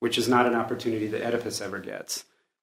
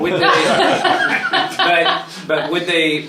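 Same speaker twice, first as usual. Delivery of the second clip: laughing; 6 semitones higher, 7 dB louder, slower than usual. Real, not acted. Laughing while asking a question.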